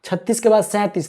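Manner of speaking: ordinary talk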